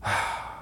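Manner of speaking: soft, satisfied exhale; contented wordless sigh. A narrator's reading. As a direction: breathe out softly after a good sip. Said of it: "exhaling a satisfied".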